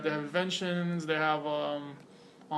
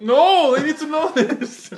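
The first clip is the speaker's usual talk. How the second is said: loud, high, drawn-out objection; loud protest; 8 semitones higher, 11 dB louder, slower than usual. Real, not acted. Podcaster objecting to co-host's complaint, loud protest. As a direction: protest loudly, dragging it out with a laugh.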